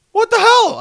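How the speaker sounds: loud, high-pitched disbelief; indignant outburst. Acted. Reacting to a friend's accusation, loud indignant outburst. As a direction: exclaim it in acted outrage.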